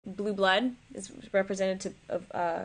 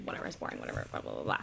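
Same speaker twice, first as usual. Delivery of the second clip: mumbled dismissive blah blah, barely voiced; bored mumble; 10 dB quieter, much faster than usual. Summing up dull dialogue, a dismissive bored mumble. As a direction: skip past it, bored. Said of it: "It's like".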